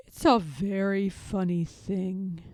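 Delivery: slow, lightly voiced bear; a slow, thoughtful Pooh. A narrator's reading. Pooh answers Piglet, slow and ponderous.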